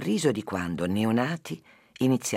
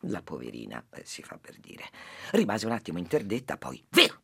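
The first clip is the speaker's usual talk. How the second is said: lively storytelling to a listener; story narration. Acted. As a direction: tell the fable with animated character voices. S0 tells a fable. (narrazione di una fiaba)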